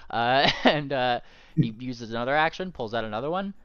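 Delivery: normal talking voice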